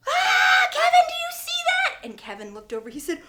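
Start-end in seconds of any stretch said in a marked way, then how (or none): 0.1-2.0 high, loud shriek running into the question; terrified scream. Acted. Teacher to Kevin on seeing the creature, screaming. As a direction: scream in panic for a witness.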